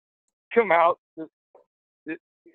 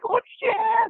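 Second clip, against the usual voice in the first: high, thrilled cheer of imitated kids; excited kids. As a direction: squeal it like a hyped teenager. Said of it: "the kid's like".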